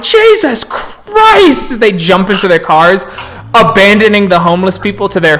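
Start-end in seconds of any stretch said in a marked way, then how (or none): 0.0-1.7 high, long-drawn exclamation; horrified cop cry. Acted. Cops seeing a severed head roll, drawn-out horrified cry. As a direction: stretch it out in high-pitched horror. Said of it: "the cops are like".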